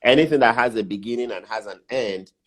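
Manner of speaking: usual speaking voice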